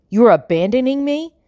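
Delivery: can't believe he'd leave her; shocked disbelief. A narrator's reading.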